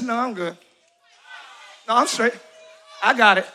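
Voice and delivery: low, soft brush-off; dismissive believer. Retold or imitated. Preacher voicing a vain person, low and dismissive. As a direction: wave it away under your breath. Voicing someone unaware of vanity.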